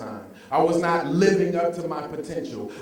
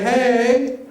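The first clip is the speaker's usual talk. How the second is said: loud, rising call for the crowd; 5 semitones higher, 8 dB louder than usual. Shown as a demonstration. Speaker leading the audience in a loud call. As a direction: pump them up. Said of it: "Everyone say it again".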